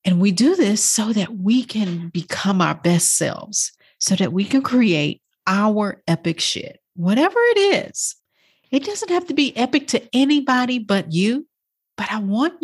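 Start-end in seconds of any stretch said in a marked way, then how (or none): none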